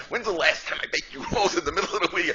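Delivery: high, loud indignant scolding; upset wife. Retold or imitated. Wife berating husband for eating her rolls, high and loud. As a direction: pitch it up and let the hurt show.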